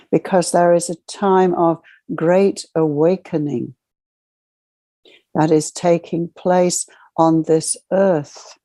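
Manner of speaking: normal talking voice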